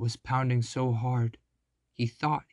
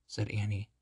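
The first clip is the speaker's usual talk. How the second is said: plain reading voice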